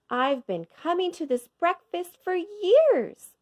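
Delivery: impressed man. A narrator's reading. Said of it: "a man said".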